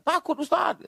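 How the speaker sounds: put-on high childlike reply; children answering. Classmates telling the preacher they are scared, high childlike voice. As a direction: answer like small scared kids.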